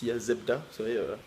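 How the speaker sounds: ordinary delivery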